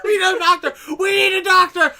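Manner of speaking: hammed-up panicked plea; mock panic. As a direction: cry out for a doctor in mock alarm. Echoing the panicked anchors.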